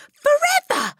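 put-on very high, loud child's wail; distressed cry. A narrator's reading. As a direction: cry it out in despair. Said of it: "Matilda cried".

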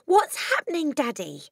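high, bewildered appeal to Dad; alarmed child. A narrator's reading. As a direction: plead to know, starting high. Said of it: "she cried".

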